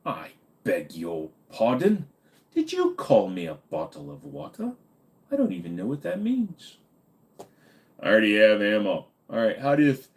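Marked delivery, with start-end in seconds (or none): 0.0-6.8 put-on English accent. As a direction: play an affronted English person, pitch swooping. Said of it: "Bottle of water with an English accent".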